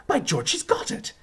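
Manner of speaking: high, excited exclamation; exultant. Acted. Teacher exulting over his pupil, excited. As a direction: marvel in a high-pitched voice.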